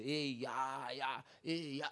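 low, soft mock singing along; lightly mocked worshipper bopping to earbuds; mock earbud listener. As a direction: imitate someone humming to their music. Mocking churchgoer with earbuds.

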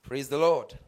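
ordinary talk

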